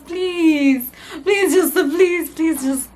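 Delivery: high, loud begging pleases; pleading. Real, not acted. Vlogger begging viewers to subscribe, high loud pleading. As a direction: beg the viewers, playfully whiny.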